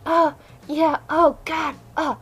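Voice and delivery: high, hammed-up fake moans; mock moaning. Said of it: "Who are always moaning".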